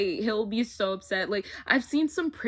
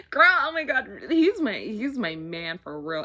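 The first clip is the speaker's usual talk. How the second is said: excited gush; 7 dB louder than usual. Real, not acted. Fan telling viewers about her crush, loud excited gush. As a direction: gush loudly about your man. Remembering her favourite character.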